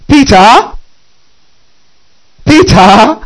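high, rising call of the name; reproachful calling. Acted. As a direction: call Peter's name in disbelief. Addresses Peter after his denial.

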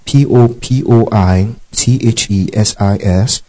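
ordinary talk, nothing marked

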